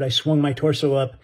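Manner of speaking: usual speaking voice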